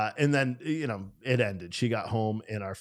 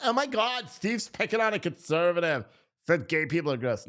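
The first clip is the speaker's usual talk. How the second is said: high, put-on outraged voice; mock outrage from an imagined chat commenter; mocking whiny critic; 6 semitones higher than usual. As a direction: sneer it like a pearl-clutching critic.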